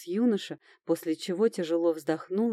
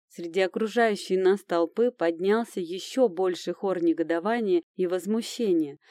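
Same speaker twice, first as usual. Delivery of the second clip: plain reading voice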